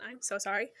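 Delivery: ordinary talk, nothing marked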